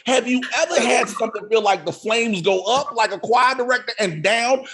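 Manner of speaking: normal talking voice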